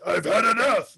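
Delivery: hammed-up fed-up dad; comically exasperated outburst; mock exasperation. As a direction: act out losing patience with the kid. Dad fed up with repeated calls.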